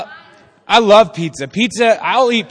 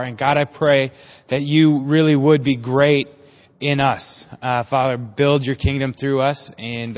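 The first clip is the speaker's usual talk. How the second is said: prayer; 5 semitones lower than usual. Real, not acted. Leads opening prayer.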